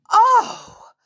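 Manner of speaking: loud, high outraged cry; indignant. A narrator's reading. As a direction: cry out in shocked protest. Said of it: "said George indignantly".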